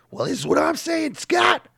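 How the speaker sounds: high shouted gruff brother; wrestling promo voice. Retold or imitated. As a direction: bark it like a wrestler. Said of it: "They were just like".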